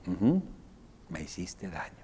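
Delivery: barely voiced echo of her pain; soft supportive whisper. Shown as a demonstration. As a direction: whisper "me hiciste daño" back gently. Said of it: "mi tono está ayudando".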